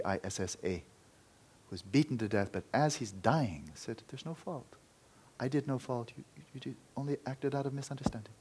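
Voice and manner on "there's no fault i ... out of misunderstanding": gentle forgiveness. Acted. Dying monk forgives gently.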